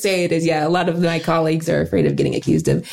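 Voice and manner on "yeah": usual speaking voice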